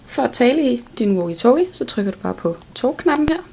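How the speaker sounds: ordinary delivery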